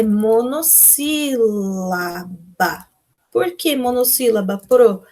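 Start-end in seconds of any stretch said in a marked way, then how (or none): 0.0-2.9 drawn out, each syllable split; slow syllable by syllable. Shown as a demonstration. Teacher to pupils, slowly spelling out the answer. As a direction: spell out the classification for the children. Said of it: "a gente classifica".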